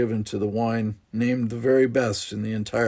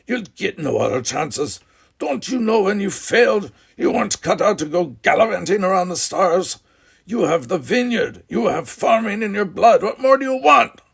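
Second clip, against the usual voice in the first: much higher, louder scolding; angry berating; 10 semitones higher, 6 dB louder than usual. Acted. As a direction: crush his dreams. Father berates son.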